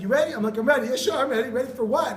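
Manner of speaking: high, loud eager back and forth; pumped-up nervous kid; excited. Retold or imitated. Kid answering dad before the ride, high and loud.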